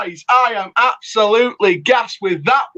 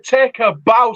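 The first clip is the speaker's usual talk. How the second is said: ordinary delivery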